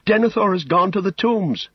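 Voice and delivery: high, urgent Pippin voice; alarmed halfling. Acted. Pippin tells Gandalf of Denethor, alarmed. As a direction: report it in a high, anxious voice.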